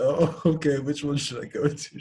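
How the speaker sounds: chuckling while asking; amused, laughing question; laughing reply. Retold or imitated. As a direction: answer through a laugh. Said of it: "I was like".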